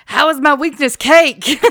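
high, loud mock-indignant shout; exasperated outburst. Quoting a film character baffled by his weakness, exasperated outburst. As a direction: yell it in comic disbelief.

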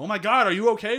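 high, loud alarmed cry; mock alarm. Drivers running to the woman, mock alarmed cry. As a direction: rush out to help, startled.

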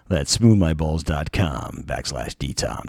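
slow, low sultry ad read. Acted. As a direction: purr it like a perfume ad. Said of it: "Gotta work into my sultry voice".

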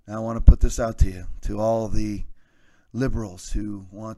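deep, soft preacher voice over organ; mock sermon, low and hushed. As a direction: pastor blessing the liberals, low and soothing. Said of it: "I'm gonna go in my lower pastoral voice".

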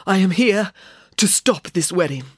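breathless, broken by gasps; panting. A narrator's reading. Exhausted woman stopping the wedding, panting. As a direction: force it out between panting breaths. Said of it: "said the woman between panting breaths".

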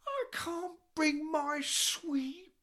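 high, very slow whining complaint; whiny indignant drummer. Retold or imitated. Drummer complaining about the room, slow high whine. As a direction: stretch every word out in a petulant whine. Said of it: "He goes".

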